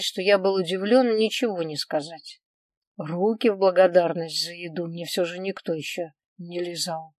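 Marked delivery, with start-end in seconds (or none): none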